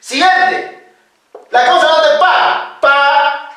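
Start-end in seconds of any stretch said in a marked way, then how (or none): 2.8-3.5 "pa" with a plain unaspirated p; simple consonant. Shown as a demonstration. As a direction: say "pa" without puff or pop. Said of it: "Consonantes simples". (consonante simple, "pa" sin soplo)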